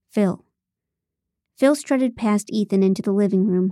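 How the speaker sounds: ordinary delivery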